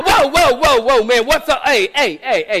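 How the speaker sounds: high startled shouts of whoa; startled yelling. Pretend. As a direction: yell in panic at a sudden visitor. Startled man facing angel in kitchen.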